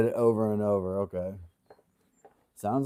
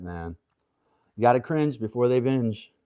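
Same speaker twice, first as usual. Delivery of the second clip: ordinary talk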